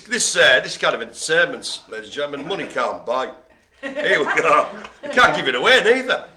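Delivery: ordinary talk, nothing marked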